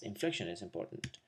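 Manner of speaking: ordinary talk, nothing marked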